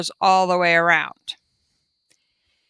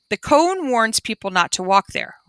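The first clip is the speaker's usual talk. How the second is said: usual speaking voice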